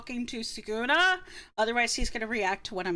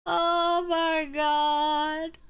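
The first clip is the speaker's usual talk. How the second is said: very slow, drawn-out disbelief; stunned amazement; 3 semitones higher, much slower than usual. Real, not acted. Streamer amazed that he let her inside, stunned. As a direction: stretch every word out in astonishment.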